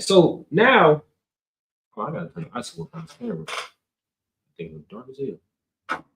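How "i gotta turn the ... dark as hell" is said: usual speaking voice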